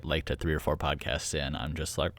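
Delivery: ordinary talk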